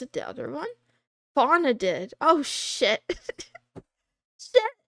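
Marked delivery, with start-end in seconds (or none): 3.1-4.9 laughing it out; laughing the word out. Real, not acted. Laughing at her own slip.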